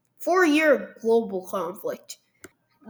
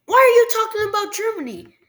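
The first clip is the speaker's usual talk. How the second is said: much higher, fast and puzzled viewer voice; mock viewer; 10 semitones higher than usual. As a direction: rattle off the objection. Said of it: "Now you might be saying".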